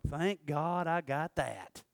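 relieved sigh. Retold or imitated. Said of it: "you'll be like".

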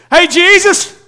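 a father calling high and loud to Jesus; desperate father calling out. Retold or imitated. Father Jairus calling out to Jesus, high and loud. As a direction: hail him urgently over the crowd. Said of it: "he met him".

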